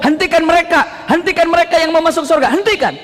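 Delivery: high, insistent order to halt; acted urgent command. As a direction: proclaim a halt at high pitch.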